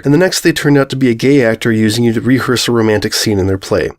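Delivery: ordinary delivery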